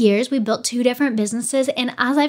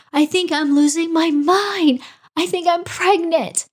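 tearful panic; 7 semitones higher than usual. Retold or imitated. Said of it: "just bawling and crying to him".

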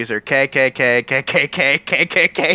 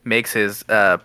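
solemn prayer voice, lower; 5 semitones lower than usual. Pretend. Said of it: "give a quick prayer".

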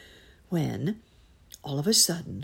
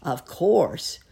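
loud, punched exclamation; emphatic cry; 3 semitones higher, 7 dB louder than usual. A narrator's reading. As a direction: cry it out as the biggest rat. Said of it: "cried the biggest rat of all".

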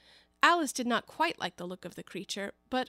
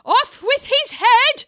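huge high slow bellow; shouting; 16 dB louder, much slower than usual. A narrator's reading. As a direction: roar the sentence slowly, way up high. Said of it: "quarreling with the other players and shouting".

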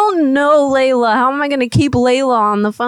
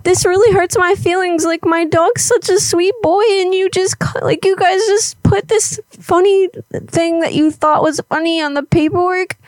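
higher-pitched mock hurt; fake crying; 6 semitones higher than usual. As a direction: play the wounded pet owner on a prank call. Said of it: "I can fake cry".

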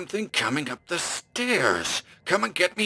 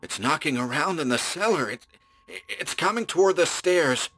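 higher and rising with fear; frightened; 7 semitones higher than usual. Acted. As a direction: fear climbing as it nears. Trapped man hears the thing.

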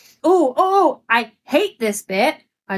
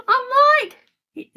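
laughing exasperation; 13 semitones higher than usual. Real, not acted. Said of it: "exasperated outburst, cry sound".

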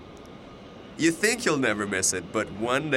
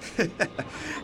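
nervous laugh; 4 semitones higher, 5 dB quieter than usual. Acted. Awkward laugh to passengers.

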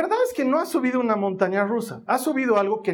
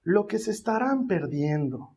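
regretful travel agent; 3 semitones lower, 5 dB quieter than usual. Retold or imitated. Imitating travel agent to dad turning down the park, pitying. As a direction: sigh it with gentle regret. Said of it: "la de la agencia de viajes me dice".

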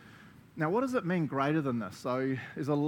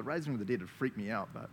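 usual speaking voice

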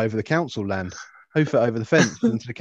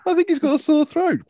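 much higher, fussy pet owner; mocking customer voice; 5 dB louder than usual. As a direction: squeak it like a worried owner. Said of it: "coming with the hamster".

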